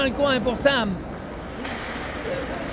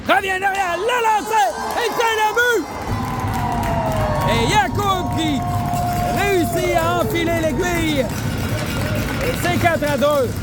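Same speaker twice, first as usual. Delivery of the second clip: excited sports commentary; 5 semitones higher than usual. Real, not acted. Goal scored, commentator shouts.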